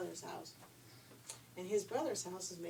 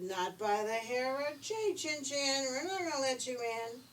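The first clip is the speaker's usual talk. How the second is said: defiant pigs; 6 semitones higher, 6 dB louder than usual. A narrator's reading. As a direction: shout it back at the wolf. Two scared pigs refuse wolf.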